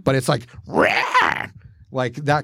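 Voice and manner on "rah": a sharp screech pitched far up; mock pterodactyl cry; pterodactyl shriek. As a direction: screech like an angry prehistoric bird. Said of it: "Like a pterodactyl?".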